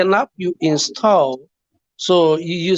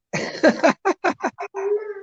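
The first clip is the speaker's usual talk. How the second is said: high giggle; 13 semitones higher, 3 dB quieter than usual. Real, not acted. Laughs at a crude question.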